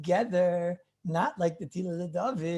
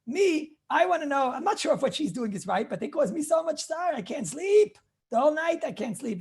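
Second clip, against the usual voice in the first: raised, higher complaining voice; pleading questioner; 6 semitones higher than usual. Acted. Rabbi voices the sleepless questioner, higher pleading complaint. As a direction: voice the sleepless man begging for a ruling.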